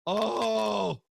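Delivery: high drawn-out gasp of fake horror; mock shocked "oh". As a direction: hold a high, scandalized "oh". Mock shock after granny search joke.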